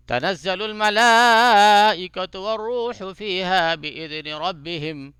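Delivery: slow, drawn-out melodic tajweed chant; Quran recitation. Shown as a demonstration. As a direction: chant each phrase slowly with long vowels. Recites with a breath break.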